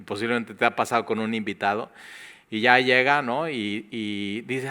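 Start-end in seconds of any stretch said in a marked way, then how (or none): none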